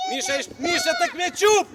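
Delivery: shout. Real, not acted. Coach calling a player to the ball.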